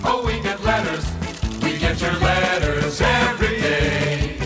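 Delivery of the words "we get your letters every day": normal talking voice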